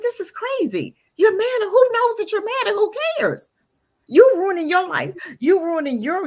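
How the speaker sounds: high, exasperated self-scolding; scolding herself. Retold or imitated. Woman fed up with her anger, scolding herself. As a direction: tell yourself off, fed up.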